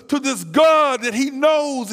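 ordinary talk

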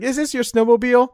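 high mock question; hammed-up mom voice; mother asking. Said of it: "He's like".

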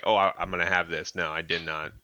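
normal talking voice

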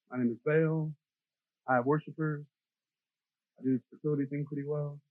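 lightly voiced smug Baal. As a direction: play a smug idol bragging. Voicing Baal smugly.

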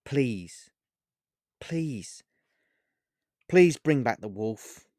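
pleading, holding back tears; separate, falling pleas with long pauses; emotional plea. A narrator's reading. Olivia begs the witch for her wolf, emotional. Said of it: "noticeably emotional Olivia, who looked to be on the verge of tears".

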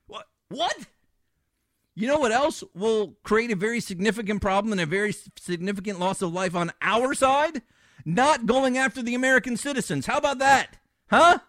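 building from heated to near-shouting; rising anger. Real, not acted. Host to listeners, fed up, rising to an angry yell. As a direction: let the outrage climb to a yell. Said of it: "I gotta calm down".

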